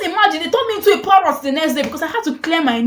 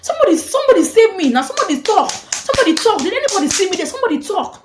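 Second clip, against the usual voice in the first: high, urgent mock plea; crying out for help; 7 semitones higher than usual. Student begging bystanders to speak up, frantic cry. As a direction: plead frantically, pitched up high.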